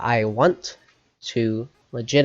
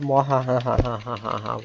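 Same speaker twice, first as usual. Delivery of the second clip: evil cackle; villainous cackle; mad scientist. Acted. Villain line read from the game.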